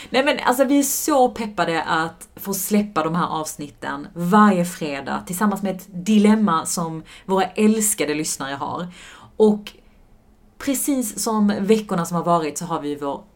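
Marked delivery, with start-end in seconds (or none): none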